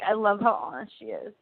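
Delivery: ordinary talk, nothing marked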